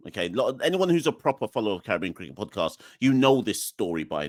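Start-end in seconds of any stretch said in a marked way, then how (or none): none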